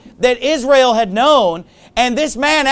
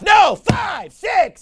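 shouted, much higher, indignant; angry prophet; 9 semitones higher, much slower than usual. Retold or imitated. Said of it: "he tells him".

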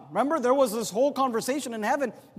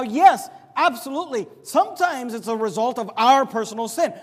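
usual speaking voice

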